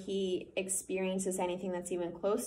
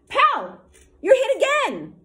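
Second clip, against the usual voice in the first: much higher, much louder gleeful shout; excited yell; 13 dB louder, slower than usual. Acted. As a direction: shout it across the field. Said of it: "Asher called from behind a tree".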